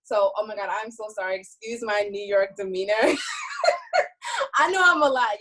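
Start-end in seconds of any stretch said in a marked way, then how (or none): 3.0-4.5 squealing laugh; high burst of laughter. Real, not acted. Laughs at her own bluntness. 4.6-5.4 laughing. Real, not acted. Laughing apology.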